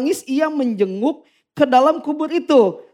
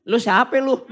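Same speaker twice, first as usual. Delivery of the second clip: a light Betawi street challenge from the angel. Pretend. Preacher imagines mother-in-law meeting angel, light Betawi. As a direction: toss off a blunt Betawi who-are-you. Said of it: "ini karena ini orang Betawi".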